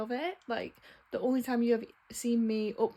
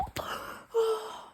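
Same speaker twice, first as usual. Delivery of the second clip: whiny sigh; 11 semitones higher than usual. Real, not acted. Frustrated over book.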